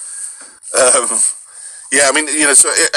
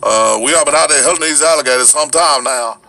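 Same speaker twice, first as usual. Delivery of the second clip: Cajun; 2 semitones higher, 3 dB louder than usual. Shown as a demonstration. Showing off Louisiana dialects, in a Cajun accent. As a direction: talk like a Cajun alligator hunter. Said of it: "that Cajun kind of thing".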